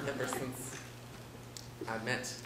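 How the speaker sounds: normal talking voice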